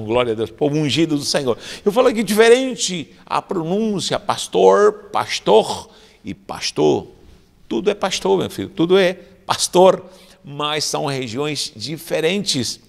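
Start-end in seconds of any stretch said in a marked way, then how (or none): none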